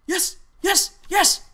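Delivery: high, loud, cracking cheer; squawky cracked celebration. Real, not acted. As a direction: yell excitedly until the voice breaks. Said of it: "that's what my voice cracks are".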